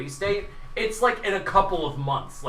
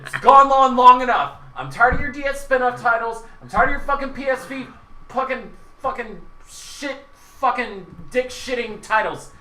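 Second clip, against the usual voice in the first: higher, louder furious tirade; angry rant; 7 semitones higher, 5 dB louder than usual. Real, not acted. Fed-up fan ranting at Square Enix, furious and loud. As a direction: vent every grievance at full blast. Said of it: "enough about my rant".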